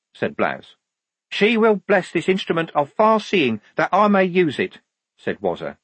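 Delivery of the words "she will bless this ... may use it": high, intoning believer; devout recruit. Acted. Devout recruit blessing the telescope, high and certain. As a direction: pronounce it like a blessing.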